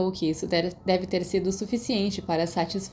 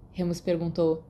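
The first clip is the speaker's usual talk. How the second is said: ordinary delivery, nothing marked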